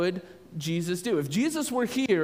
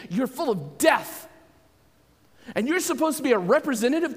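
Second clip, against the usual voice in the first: indignant rebuke; 4 semitones higher than usual. Retold or imitated. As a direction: pour out the disgust at them. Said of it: "And he's like".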